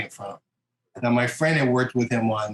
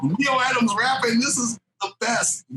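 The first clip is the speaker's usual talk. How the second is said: delighted; 11 semitones higher than usual. Retold or imitated. Retold delight, pitched high.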